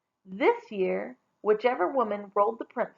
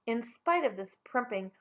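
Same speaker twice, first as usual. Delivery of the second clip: ordinary delivery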